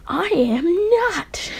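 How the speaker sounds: high, drawn-out indignant denial; acted flustered tsundere protest; frustrated, blushing. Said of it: "Like, obviously frustrated and blushing".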